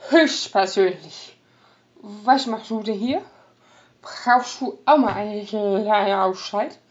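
loose, slurred tipsy greeting; drunken slurring. Acted. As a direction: slur the words like a happy drunk. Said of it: "Lallend begrüßte Ota".

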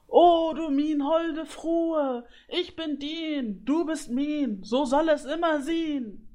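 high, drawn-out lovesick declamation; calling out. A narrator's reading. Griffin serenades the fleeing geese from afar, calling out. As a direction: wail the verse slowly across the sky. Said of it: "die Grüffel ihnen zurief".

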